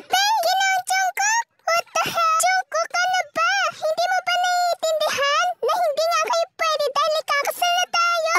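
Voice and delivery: shocked fiancée. Acted. Fiancée begging [name], high and shrill. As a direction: plead with him in disbelief.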